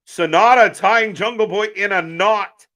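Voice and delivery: hyped play-by-play shout; excited ringside call. Real, not acted. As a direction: call the move like a fan on the edge of the seat. Calling the live match action.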